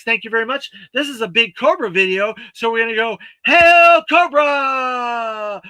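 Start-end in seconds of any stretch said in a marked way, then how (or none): 3.5-5.6 villain salute shout. Pretend. Signing off big Cobra video.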